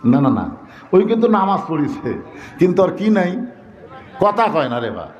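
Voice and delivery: ordinary talk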